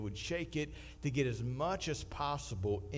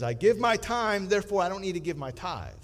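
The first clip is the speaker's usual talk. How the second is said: mock churchgoer; 5 semitones higher, 7 dB louder than usual. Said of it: "A misunderstanding is".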